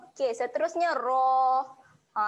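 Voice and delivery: ordinary talk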